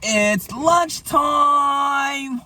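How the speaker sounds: drawn-out high shout; excited shout. Real, not acted. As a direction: announce it slowly at full volume. Announcing lunchtime loudly.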